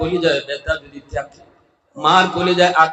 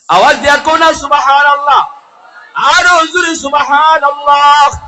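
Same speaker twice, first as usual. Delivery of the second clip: high, loud shout to the congregation; preacher demanding a louder response; rousing call; 11 semitones higher, 9 dB louder than usual. Real, not acted. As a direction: whip the crowd into answering.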